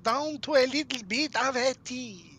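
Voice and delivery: a hint of a French accent. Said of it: "I have the accent down".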